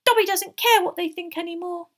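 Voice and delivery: high, loud elf squeak; squeaky, rebellious house-elf; defiant. A narrator's reading. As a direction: declare it defiantly as a little elf. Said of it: "said Dobby defiantly".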